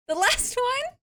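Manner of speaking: LAST ONE lifted high, rising at the end; rising repeat. Real, not acted.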